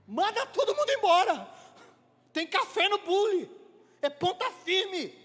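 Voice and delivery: high, excited exclamation; emphatic approval. Acted. Interviewer won over by bold applicant, excited approval. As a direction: call it out, won over by the nerve. Impressed interviewer's reply acted out.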